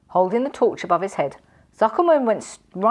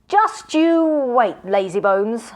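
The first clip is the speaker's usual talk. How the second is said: slow, high, loud menace on wait; threatening; 4 semitones higher, 4 dB louder, much slower than usual. A narrator's reading. Magician threatening his lazy servant, slow loud menace. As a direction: draw out the threat. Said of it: "repeated the magician".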